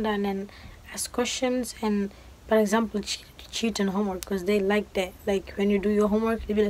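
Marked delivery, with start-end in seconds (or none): none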